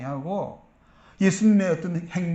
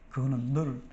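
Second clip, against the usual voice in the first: ordinary talk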